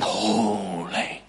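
low, soft, drawn-out reverence; hushed worship. Acted. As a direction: breathe it out in quiet awe. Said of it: "And they're saying".

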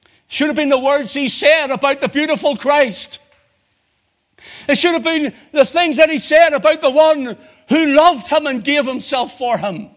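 a sermon building to its peak; full-force preaching. Acted. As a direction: thunder it from the pulpit.

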